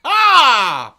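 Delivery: loud, high sassy reply; mock sassy retort to the woman; sassy comeback. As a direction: snap it back with attitude. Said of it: "I'd be like".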